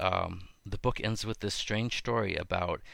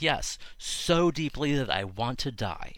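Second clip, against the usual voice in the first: higher, drawn-out declaration of misery; melodramatic Jonah; 5 semitones higher, 3 dB louder than usual. Acted. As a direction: wallow in it, slowly and pitched up. Acting Jonah's sulky answer to God.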